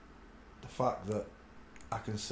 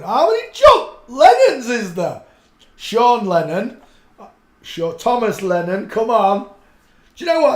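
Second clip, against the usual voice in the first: high, loud exasperated calling out; loud disbelief at the search results; frustrated outburst; 8 semitones higher, 14 dB louder than usual. Real, not acted. Yelling at missing search results, exasperated and loud.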